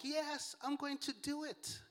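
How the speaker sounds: put-on high, soft, dainty British accent. Cute movie Mary answers the angel, in a high British accent. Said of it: "in the British accent".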